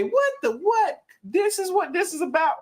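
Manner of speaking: high, incredulous what the; surprised. Real, not acted. Reacting in disbelief.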